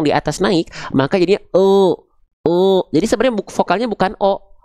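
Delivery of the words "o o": raised, held "O" from a lifted tongue back; thick, drawn-out "O"; O-like vowel. Shown as a demonstration. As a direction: let A come out as "O" with the back of the tongue up. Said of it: "ketika momentum vokal A mau keluar".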